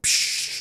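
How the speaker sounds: hissed "psh" like cymbals; imitated cymbal crash. Shown as a demonstration. Music reactor describing the song's open space, imitating cymbals. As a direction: mimic a cymbal splash with your mouth.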